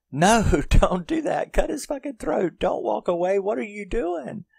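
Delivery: very high, loud mock shriek; hammed-up falsetto yelling at the screen; imitated crowd screaming. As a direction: squeal like a frantic movie audience. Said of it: "everybody was screaming".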